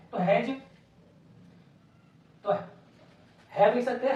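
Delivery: normal talking voice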